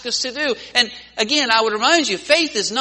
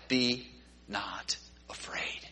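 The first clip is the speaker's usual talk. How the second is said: whispered reassurance; 9 semitones lower, 13 dB quieter, much slower than usual. Acted. Jesus calming the disciples.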